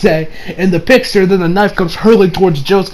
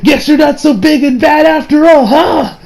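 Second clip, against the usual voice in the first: high, gloating acted taunt; mocking taunt; 9 semitones higher than usual. Retelling Josuke taunting the trapped villain, mocking. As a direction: jeer at the beaten bad guy, pitch raised.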